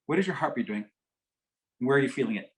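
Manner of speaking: ordinary delivery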